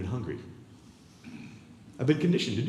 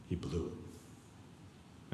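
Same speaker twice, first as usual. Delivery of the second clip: whispered shame; 7 semitones lower, 16 dB quieter, much slower than usual. Acted. Guessing Peter's inner shame.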